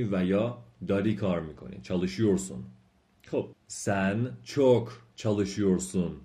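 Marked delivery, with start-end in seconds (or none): 3.7-6.1 slow model reading. Shown as a demonstration.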